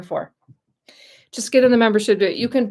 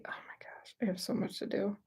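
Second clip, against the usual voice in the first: whispering to herself, overwhelmed; whispered aside; 2 semitones higher, 15 dB quieter than usual. Real, not acted. To herself about her long to-do list, a hushed whisper. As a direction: breathe it out under her breath.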